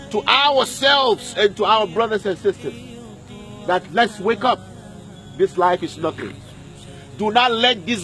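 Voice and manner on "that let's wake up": ordinary delivery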